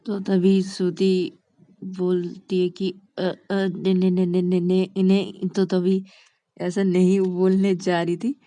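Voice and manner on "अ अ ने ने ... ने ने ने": stuck repeating one syllable; stuttered refusal. Acted. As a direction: stammer without getting the word out. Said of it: "ऐसे हकला क्यों रही हो".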